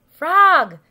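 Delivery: loud, high call; crying out. A narrator's reading. Toad calls from the high wire, crying out. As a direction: call out sharply to a friend. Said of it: "cried Toad".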